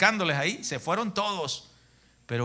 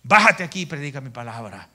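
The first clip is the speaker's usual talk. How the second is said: "Bájate aquí" much louder, pressing, "predica" driven hard; insistent command; 2 semitones lower, 7 dB louder than usual. Retold or imitated. The inner voice insists the pastor stop, loud command. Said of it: "el Espíritu Santo me dice".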